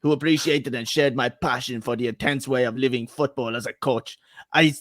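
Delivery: put-on Italian accent for a solemn statement; Antonio Conte accent. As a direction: read like an Italian coach in halting English. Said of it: "can you just do the accent?".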